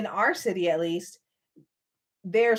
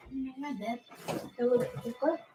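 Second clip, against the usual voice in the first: much softer, half-turned aside; 1 hushed aside; 4 semitones higher, 7 dB quieter, much faster than usual. Real, not acted. Telling her child she's busy, in a much softer aside.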